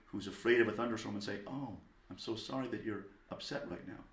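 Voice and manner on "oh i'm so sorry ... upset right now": soft, tender sympathy; hushed, caring apology; warm. Acted. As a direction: gently comfort a scared child. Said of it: "Give the child warmth".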